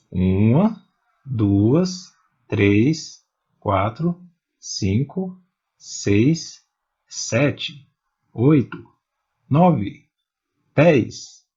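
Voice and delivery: each number from "Uma" to "dez" separate, with rising tune; list-like count up to "dez", every number left hanging; counting one by one. Shown as a demonstration. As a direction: count "Uma, duas, três" with a pause after each, lifting the end.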